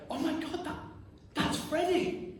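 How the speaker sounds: high, laughing amazement; acted joyful recognition. Acting joyful recognition in others' memories.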